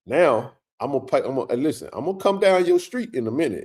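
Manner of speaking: usual speaking voice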